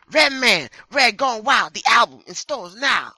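loud, high hype announcer voice. Acted. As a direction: shout it like an ad announcer. Announcer voice reading Redman menu.

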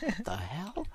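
high, startled what the hell; shocked disbelief. Retold or imitated. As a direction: gasp it out in disbelief. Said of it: "I'm like".

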